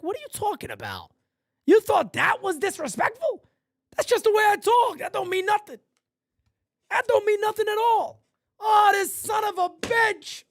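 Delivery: high, baffled, mock-indignant disbelief; incredulous retort. Recalling a clubhouse chat, a mock incredulous scoff. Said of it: "I'm sitting there looking around like".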